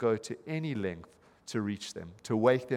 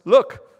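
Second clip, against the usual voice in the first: 'Look' much louder and higher, rising; stress on Look; 9 semitones higher, 13 dB louder than usual. Retold or imitated. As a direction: hit 'Look' hard and loud like a call from the pulpit. Preacher punching the key word.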